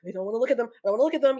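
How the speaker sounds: urgent, rising panicked repetition; mock panic. Recalling freak-outs at clowns on screen, mock panicked. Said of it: "I would have a panic attack".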